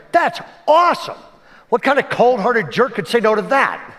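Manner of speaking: high, loud burst of mock amazement; retold enthusiasm. Pastors hearing the swat team story, acted excitement. Pastors react eagerly to the plan.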